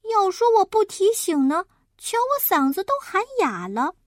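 put-on high-pitched kid grumble; aggrieved child. Child grumbles inwardly about grandma, high and aggrieved. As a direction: complain like a wronged child.

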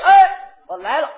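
loud, high, delighted "哎"; happy answer. Acted. Answering a friend's call, a loud happy yes. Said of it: "我还特别的高兴".

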